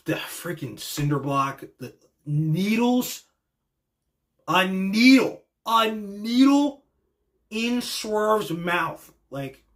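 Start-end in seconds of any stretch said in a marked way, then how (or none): 1.8-9.1 slow, incredulous stress on NEEDLE; appalled emphasis. Real, not acted. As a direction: NEEDLE drawn out, rising higher in disbelief. Appalled recounting of brutal match.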